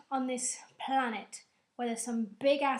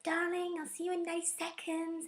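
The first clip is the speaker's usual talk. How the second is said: higher, softer mock lover's farewell; mock tender; 6 semitones higher, 4 dB quieter than usual. Uhura saying goodbye to Spock, mock tender coo. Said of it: "Uhura's just like".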